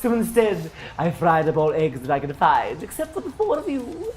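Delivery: high, preening satyr; vain satyr voice. Acted. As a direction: play the smug creature host.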